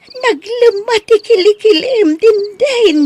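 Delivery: high, loud shocked outcry; horrified shout. Acted. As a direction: cry out in horror at the wound. Nana sees bruise on armpit. (nakigtot nga ikkis)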